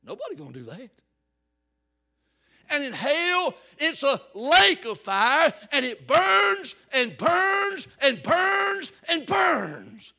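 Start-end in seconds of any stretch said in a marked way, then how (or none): 2.7-10.1 loud, high fire-and-brimstone preaching; preaching at full force. Acted. Preacher warning the church about hell, full-force shouting. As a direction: thunder it at the congregation.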